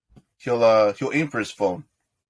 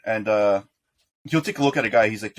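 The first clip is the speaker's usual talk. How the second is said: ordinary talk, nothing marked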